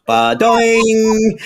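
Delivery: high, playful ba-doing; a light childlike sound effect; kid's sound word. Retold or imitated. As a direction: bounce the word like a spring.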